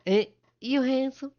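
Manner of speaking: high-pitched, forward character voice; woman at the desk. Acted. Woman gives her name to the visitor, high and forward. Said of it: "woman probably about the, the same age".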